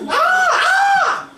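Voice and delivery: high mocking shriek imitating worshippers; hammed-up cry of a frenzied worshipper; mock ecstatic shriek.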